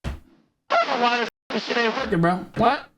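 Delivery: speech through an electronic voice changer; processed, artificial-sounding voice effect. Shown as a demonstration. Streamer testing a voice changer mid-story, artificially altered voice. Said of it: "Like that, but higher pitched".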